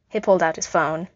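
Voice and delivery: plain reading voice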